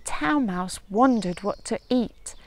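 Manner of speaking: ordinary delivery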